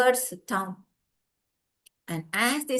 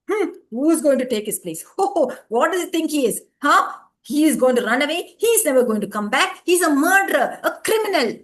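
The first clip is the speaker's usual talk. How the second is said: loud, scornful heckling; mock the condemned man; jeering crowd; 4 semitones higher, 7 dB louder than usual. A narrator's reading. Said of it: "said the people in the crowd".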